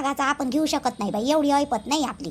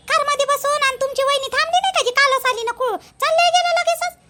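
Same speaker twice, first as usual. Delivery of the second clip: surprised that the aunt left already; 9 semitones higher, 4 dB louder than usual. Acted. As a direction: ask it up high, unable to believe she left.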